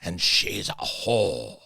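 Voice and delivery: slow, drawn-out mock proclamation; deliberate, defiant imitation; doubling down. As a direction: stretch every word of the insult. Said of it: "And he doubles down".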